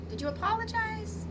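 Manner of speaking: usual speaking voice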